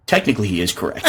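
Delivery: low, quiet dry dad voice; deadpan dad. Retold or imitated. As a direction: state it dry and matter-of-fact. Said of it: "And my dad goes".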